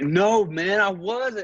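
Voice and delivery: high, loud mock protest; imitated denial. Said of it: "And he's like".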